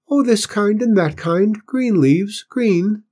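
put-on high, clear little boy's voice; small boy piping up in a high voice; squeaky boy. A narrator's reading. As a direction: squeak it out, high and eager to explain. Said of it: "in a clear but squeaky voice".

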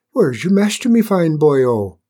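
put-on a boy asking a big dog kindly; Finn asking. A narrator's reading. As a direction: coax the dog with a bright lilting question.

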